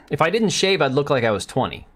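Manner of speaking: ordinary talk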